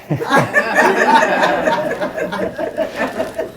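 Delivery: high breathy laugh, no voice; nervous silent laugh. Retold or imitated. Sitting tensely in the husband's car, breathless laugh.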